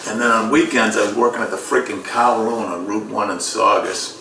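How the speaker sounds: ordinary delivery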